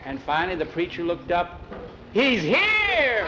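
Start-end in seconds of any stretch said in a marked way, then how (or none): 2.1-3.3 high, drawn-out exclamation; startled high-pitched shout; astonished cry. A narrator's reading. Preacher looks up and cries out.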